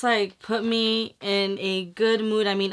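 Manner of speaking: usual speaking voice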